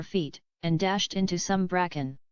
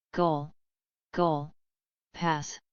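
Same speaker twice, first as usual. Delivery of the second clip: lightly called out, slower on the last words; a hint of a flustered vicar calling out; mild cry; slower than usual. A narrator's reading. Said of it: "cried Mister Beebe".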